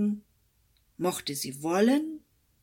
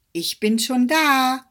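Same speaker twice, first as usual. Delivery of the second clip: loud high triumphant call; 7 semitones higher, 9 dB louder than usual. Acted. Hedgehog calling to the exhausted hare, loud and triumphant. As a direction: crow it out once more. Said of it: "rief der Igel oder seine Frau".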